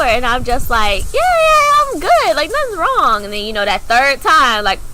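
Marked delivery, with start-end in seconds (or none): 1.1-3.2 high, loud, nervous "I'm fine"; forced-cheerful denial, loud and high; overly insistent. Retold or imitated. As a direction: brush him off too eagerly, pitched way up. Insisting to dad she's fine.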